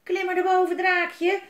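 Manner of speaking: calling the dragon up the ladder. Acted. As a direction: shout up to the little dragon, high and loud. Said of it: "riep Elise".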